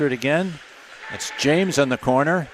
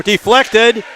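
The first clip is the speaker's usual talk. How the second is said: punched, louder, higher play-by-play; excited save call; 5 semitones higher, 7 dB louder than usual. Real, not acted. Announcer calling a deflected shot saved by Shadler, excited.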